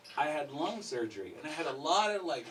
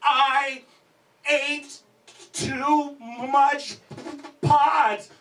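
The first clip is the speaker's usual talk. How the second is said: high, loud, word by word; panicked stoner confession; desperate confession; 10 semitones higher, 8 dB louder, much slower than usual. Retold or imitated. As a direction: wail each word with pauses. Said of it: "And I'm like".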